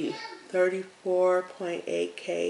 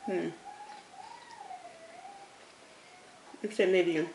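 chewing while talking; muffled closed-mouth hum; mouth full; 7 dB quieter than usual. Real, not acted. Seen 1: Bites at -1; closed, full, chewing mouth through the labelled words.

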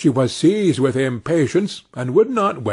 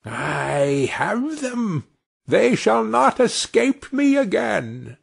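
slow, gloating witch voice, pitched up; mocking witch; 8 semitones higher, slower than usual. A narrator's reading. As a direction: savour the catch like a wicked old witch. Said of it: "said mockingly".

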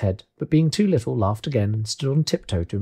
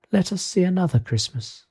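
higher, gently commanding spirit voice; ghostly spirit; 6 semitones higher than usual. Acted. The Spirit waves its hand to change scene, gently. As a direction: invite from a higher, even register.

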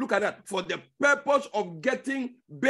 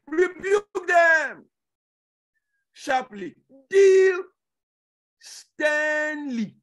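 loud, high, slow rebuke; preaching shout; 7 semitones higher, 5 dB louder, much slower than usual. Acted. Preacher to congregation, shouting the rebuke. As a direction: thunder the command from the pulpit. Preacher reads command with force.

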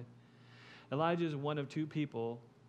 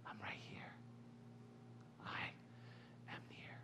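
soft, intimate whisper; whispering as God; 5 semitones lower, 17 dB quieter than usual. Acted. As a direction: speak God's comfort in a bare whisper. God's answer after cry.

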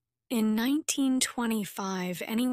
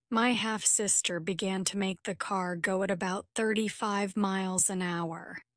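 ordinary delivery, nothing marked

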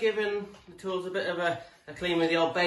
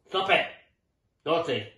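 scolding; 2 semitones lower than usual. Real, not acted. Scolding the dog.

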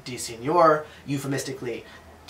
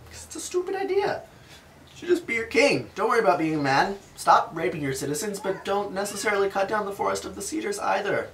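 mock elders; 7 semitones higher than usual. Voicing the elders.

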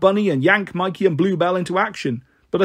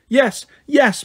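put-on high, whiny wail of a hungry little girl; a child's wail, pitched well up; 9 semitones higher, 3 dB louder than usual. Little girl wailing to the woman for food. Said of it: "Pixie wailed".

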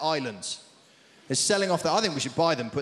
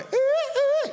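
excited squeal. Acted. Greeks excited inside the club.

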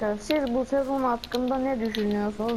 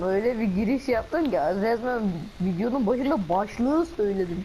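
ordinary talk, nothing marked

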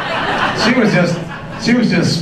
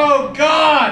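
high, drawn-out cry to heaven; hammed-up passionate wail; mock ecstatic cry; 11 semitones higher than usual. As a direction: imitate a lover crying out. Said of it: "then she threw in God".

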